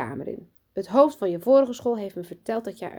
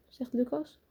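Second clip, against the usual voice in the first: plain reading voice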